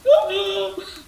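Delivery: high, loud scream-gasp; terrified gasp. Acted. Rex diving under the couch in fright, a loud gasp-scream. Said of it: "With a terrified scream".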